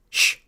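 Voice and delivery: sharp hissing shush; hissed shush. A narrator's reading. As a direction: shush someone with a hiss. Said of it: "several people hissed".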